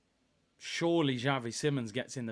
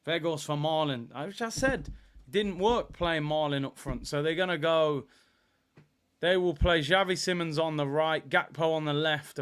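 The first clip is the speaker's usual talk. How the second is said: ordinary talk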